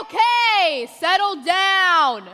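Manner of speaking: loud calming. Acted. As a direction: break up the fight. Cop breaks up a fight.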